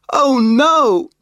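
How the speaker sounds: high, loud cry of alarm; yelling. A narrator's reading. Biff yells as her hat blows into the lake, loud cry. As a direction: yell as the hat flies off. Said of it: "Biff yelled".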